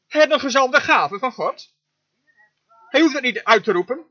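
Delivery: ordinary talk, nothing marked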